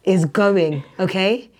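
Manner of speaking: loud, deliberate telling-off; re-enacted strict teacher, building in volume; stern teacher warning. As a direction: lay down the law to a class. Acts stern warning to class.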